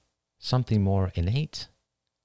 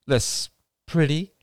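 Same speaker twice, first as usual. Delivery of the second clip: teasing; 9 semitones higher, slower than usual. Acted. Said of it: "Rachel teased".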